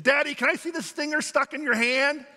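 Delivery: put-on high, eager child's voice; curious little girl. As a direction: ask like a curious little kid. Curious little girl asks dad.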